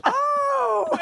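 high, crying-out oh of shock; horrified gasp. Retold or imitated. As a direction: let it out as a shocked little wail. Horrified reaction, retold.

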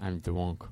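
put-on drunk said as twunk; I'm drunk with a w for the r; drunk with a speech impediment. Shown as a demonstration. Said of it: "Say you're drunk".

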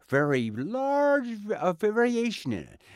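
high, wildly swooping blob voice; Krang pitch demo. Shown as a demonstration. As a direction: push the pitch way up and wobble it. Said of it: "Okay, pitch".

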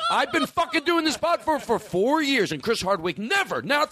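high, indignant outburst, played for laughs; hammed-up exasperated tirade; mock angry rant. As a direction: rant like you've been wronged for years. Acted tirade before the sign-off jingle.

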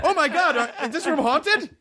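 high-pitched playful panic; hammed-up high alarm; mock fright. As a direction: pretend to be spooked, with a laugh. Mock fright at a ghost joke.

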